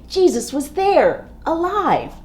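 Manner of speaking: high and loud with delight; joyful surprise. A narrator's reading. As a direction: burst out with the good news. Surprise resurrection moment.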